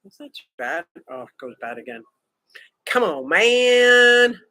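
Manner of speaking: ordinary talk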